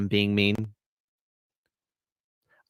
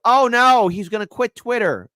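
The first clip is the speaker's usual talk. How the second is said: high, loud mock horror; sarcastic fake alarm; mock outrage; 7 semitones higher, 5 dB louder than usual. Mocking the quitter.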